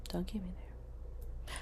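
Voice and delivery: whispered warning, barely voiced. Real, not acted. Whispering a warning to a man who tests her. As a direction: hush it down to a threat.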